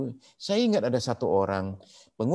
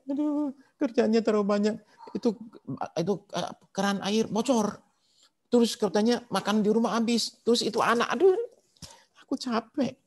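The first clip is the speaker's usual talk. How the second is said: high-pitched mock sobbing complaint; istri menangis; 10 semitones higher than usual. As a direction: imitate an overwhelmed wife wailing on the phone. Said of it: "Istrinya lagi nangis". (menirukan istri menangis dengan suara tinggi)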